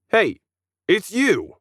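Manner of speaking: mock-surprised alien. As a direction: spot the kid and jeer. Alien recognizes the kid.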